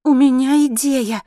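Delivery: high, loud, eager woman; keyed-up, impatient announcement. A narrator's reading. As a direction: blurt it impatiently, stretching the words. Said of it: "нетерпеливо пробормотала она".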